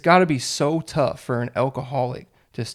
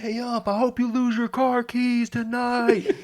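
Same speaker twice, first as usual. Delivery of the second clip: heckling the umpire; 11 semitones higher than usual. Retold or imitated. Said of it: "you yelling the most nicest insults".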